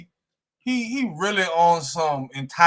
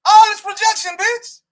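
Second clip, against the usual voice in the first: high, loud jeering outburst; loud taunt; 11 semitones higher, 5 dB louder than usual. Real, not acted. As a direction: throw it at your rival, pitched way up. Taunts rival over his projection.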